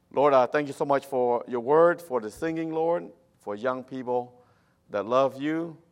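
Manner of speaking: ordinary talk, nothing marked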